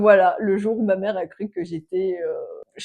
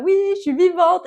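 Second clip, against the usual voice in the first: high, louder retold reassurance; mock reassurance; 10 semitones higher, 5 dB louder than usual. Daughter tells worried mother on phone she's alive, acted high-pitched reassurance. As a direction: pipe up high to reassure mum, re-enacted.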